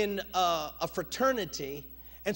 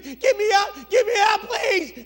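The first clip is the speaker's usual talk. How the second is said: very high, loud pleading yell; retold desperate screaming; 11 semitones higher, 12 dB louder than usual. Freshman hears the train coming and screams for mercy. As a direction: scream for rescue as the trapped freshman. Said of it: "and he's screaming".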